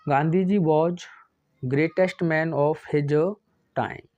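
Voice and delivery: slow, word by word, for copying; slow dictation. Shown as a demonstration. Teacher dictating the next question, slow and deliberate. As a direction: dictate it slowly so the class can write.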